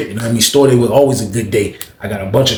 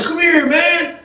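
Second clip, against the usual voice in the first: calling out; much slower than usual. Retold or imitated. Jeff shouts back.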